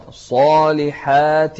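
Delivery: الصالحات with a thick "saw", then a thin "haat"; heavy sad, light ending. Shown as a demonstration. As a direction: thicken the first syllable of الصالحات, lighten the rest. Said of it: "التفخيم في الصاد والترقيق".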